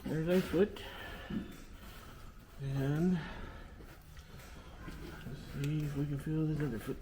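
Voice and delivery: soft, high, gentle murmur; talking to animals. Real, not acted. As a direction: murmur gently to the ewe while working. Talking softly to a ewe.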